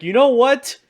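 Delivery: loud, high burst of resolve; excited exclamation. Retold or imitated. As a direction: throw it out loud and pumped. Gary's sudden decision, loud.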